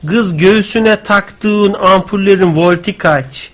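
put-on slow, drawn-out Laz accent reading; Laz-accented recital, word by word. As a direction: read it slowly in a Laz dialect. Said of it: "Laz şivesiyle diyor".

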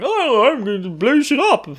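high, hammed-up villain voice; mock a game villain way up high; Vayne Solidor. Villain announcing his plan, high-pitched mock voice. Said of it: "Vayne Solidor going".

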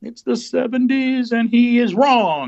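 high, slow, building to a loud verdict; game-show announcer. Pretend. Host announcing contestant's first wrong answer, mock-dramatic and loud. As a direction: stretch out the big reveal.

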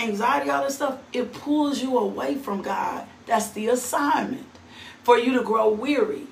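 usual speaking voice

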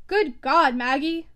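loud furious cry; exclamation. A narrator's reading. Stephen hearing Maggie will go back, loud outburst. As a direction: burst out at her, loud.

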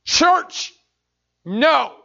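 mock the daughter's plea angrily; drunk father yelling. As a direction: drunk dad's scornful yell. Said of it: "And he said".

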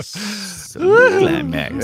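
slow mock-dramatic echo; mocking slow repeat. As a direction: stretch it out like a dirty joke. Mocking the movie line.

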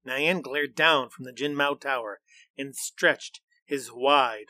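usual speaking voice

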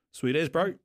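laid-back Kiwi bloke. Retold or imitated. Retold casual reply to fan.

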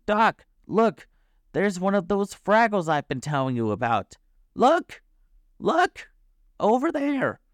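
high, insistent dog's voice; high-pitched, pressing pleas to look; excited barking. A narrator's reading. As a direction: urgently yap for attention. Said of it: "he barked".

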